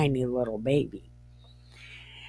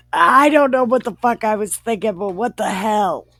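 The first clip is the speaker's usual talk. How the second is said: loud, high, rapid retold outburst; mock outburst, high and loud; acted exasperated rant; 6 semitones higher, 6 dB louder, faster than usual. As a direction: play an exasperated wife, pitched up and hurried. Acted wife ranting at husband.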